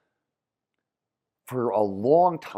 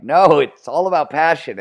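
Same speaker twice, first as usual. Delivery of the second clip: mocking quote; 9 semitones higher, 13 dB louder than usual. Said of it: "they're like".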